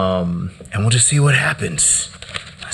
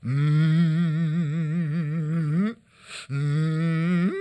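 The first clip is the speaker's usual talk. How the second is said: spoken-word poem with moans between lines; poetry performance; 5 semitones higher than usual. Acted. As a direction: preach the words, then hum.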